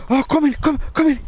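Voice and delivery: high, flustered woman's voice; embarrassed woman. Retold or imitated. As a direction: gush the welcome like a flustered neighbour. Embarrassed woman invites them in.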